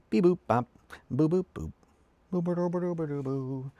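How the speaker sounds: high sing-song beep boop noises; robot beeping. Pretend. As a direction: hum little machine beeps. Making robot noises while building.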